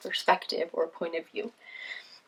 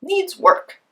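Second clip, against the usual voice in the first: lion voice; 11 semitones higher, 12 dB louder than usual. Acted. Lion judges the roar.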